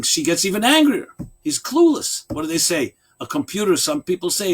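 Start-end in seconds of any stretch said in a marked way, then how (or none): none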